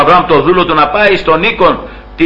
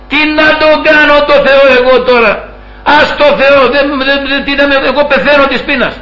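desperate hungry Esau; 8 semitones higher than usual. Retold or imitated. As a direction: wail it out like a starving man who cares only for food. Esau scorns God for food.

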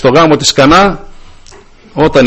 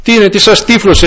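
Paul rebuking; 7 semitones higher than usual. Retold or imitated. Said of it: "λέει".

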